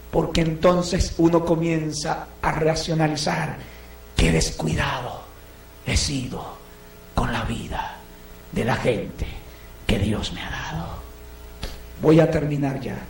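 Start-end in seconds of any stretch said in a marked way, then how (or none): 4.2-10.9 low, soft regretful lament; much lower, hushed self-reproach; remorseful murmur. Retold or imitated. The careless siblings regretting at the wake, low and soft.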